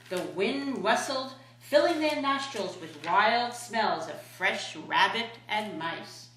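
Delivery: plain reading voice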